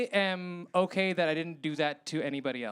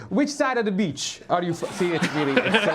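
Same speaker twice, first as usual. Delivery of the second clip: higher-pitched, muddled Jamaican-Irish accent; 4 semitones higher, 4 dB louder than usual. Shown as a demonstration. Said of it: "a Jamaican guy that sounds kinda Irish".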